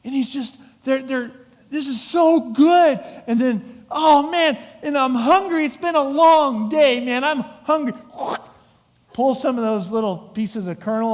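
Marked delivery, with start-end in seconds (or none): 1.7-3.0 higher, louder and slow, savouring the taste; hammed-up happy disciple relishing food; delighted disciple. Disciples savouring grain, retold. 3.9-7.9 higher and louder, groaning with hunger; hungry disciple. Retold or imitated.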